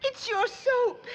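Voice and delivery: tearful whimper. Acted. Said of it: "what's got you to bawling".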